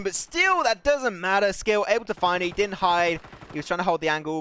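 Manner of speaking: high, loud esports commentary burst; excited play-by-play. Real, not acted. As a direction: call the decisive kill at full volume. Live play-by-play at climax.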